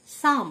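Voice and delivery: "সাম" starting straight on S; silent P. Shown as a demonstration. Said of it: "পি silent". ("সাম", শুরুতে পি উচ্চারণ না করে)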